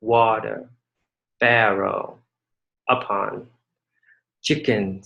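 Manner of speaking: Water, barrel, upon, chicken, each said with a falling tune; slow falling word-by-word stress demo; stressed word list. Shown as a demonstration. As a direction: lean on each word and let the tune fall. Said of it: "the first stress".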